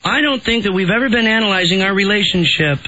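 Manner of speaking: high whiny woman voice; Amber Fry voice. Acted. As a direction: whine it out like a clingy girlfriend. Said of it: "way too good at the whiny woman voice".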